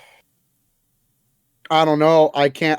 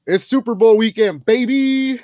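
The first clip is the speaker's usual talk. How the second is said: excited hype; 7 semitones higher, 3 dB louder than usual. Real, not acted. Host hyping Super Bowl weekend to co-host, excited. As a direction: whoop up the big weekend.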